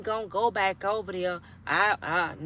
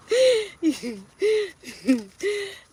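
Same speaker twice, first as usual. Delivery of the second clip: high laugh; 11 semitones higher, 3 dB louder than usual. Real, not acted. Laughs after story.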